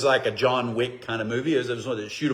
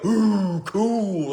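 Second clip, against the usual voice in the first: mock awe; 6 semitones higher than usual. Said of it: "We would go".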